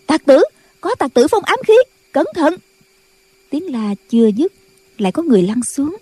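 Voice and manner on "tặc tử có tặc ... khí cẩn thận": put-on higher, alarmed warning of a young nun; disciples calling out. A narrator's reading. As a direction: shout a warning about hidden darts. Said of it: "cũng la lên".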